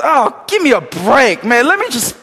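hammed-up annoyance at a friend; mock exasperation. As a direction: pastor moaning at his friend to let him prep. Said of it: "I'm just".

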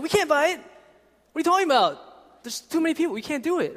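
high, loud frantic outburst as Andrew; panicked Andrew. Retold or imitated. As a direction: play Andrew freaking out at the impossible task. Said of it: "And Andrew freaks out".

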